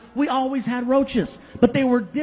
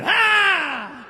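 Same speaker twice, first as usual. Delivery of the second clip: high, louder scare shout; mock yell; 7 semitones higher, 4 dB louder than usual. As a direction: yell to scatter the roach. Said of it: "I said".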